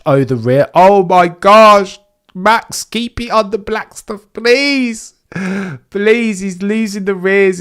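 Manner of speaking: high, loud anxious cries; panicked pleading. Real, not acted. Fan begging Max as he loses the rear. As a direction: beg him to keep it on track.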